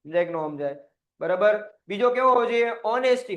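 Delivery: usual speaking voice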